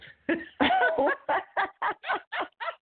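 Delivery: burst of laughter. Real, not acted. Laughing at dog doo-doo image.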